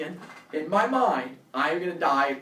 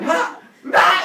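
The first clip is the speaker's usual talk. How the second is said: startled yelp; 13 dB louder than usual. Retold or imitated. Door slams back, screaming.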